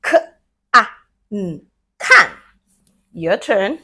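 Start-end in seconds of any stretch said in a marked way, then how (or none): 0.0-2.3 each sound said apart, then the word; segmented blending. Shown as a demonstration. Teacher showing kids how to blend, sound by sound. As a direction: pause between K, ah, mm, then say can. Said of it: "My turn again".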